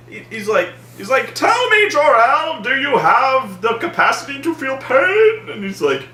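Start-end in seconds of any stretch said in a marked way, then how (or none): 1.3-5.5 high, hammed-up villain interrogation; Zod menacing. Zod questioning Jor-El, menacing villain. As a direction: threaten theatrically in a strained voice. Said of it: "threatening the hologram".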